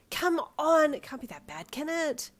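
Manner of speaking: coaxing, up and down; cajoling. Acted. Girlfriend coaxing boyfriend toward a bad house, cajoling. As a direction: coax him along. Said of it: "with a cajoling chuckle".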